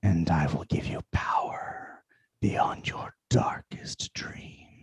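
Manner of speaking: slow, deep, whispering dagger voice; menacing voice in your mind; booming arrogant alien overlord. Acted. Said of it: "in a deep, booming voice".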